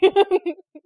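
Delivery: high, loud burst of laughter; real laugh at the freebie being stolen. Real, not acted. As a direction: laugh out at the irony.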